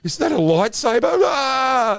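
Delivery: high, loud delighted gasp-question; overjoyed actor spotting a lightsaber; thrilled discovery. Retold or imitated. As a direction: squeal with surprise at the gift.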